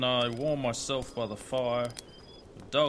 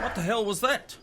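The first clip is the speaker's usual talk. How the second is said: sharply higher startled question; much higher, alarmed outburst; 9 semitones higher than usual. Acted. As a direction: jolt at a sudden noise in the dark. Startled by a noise at night.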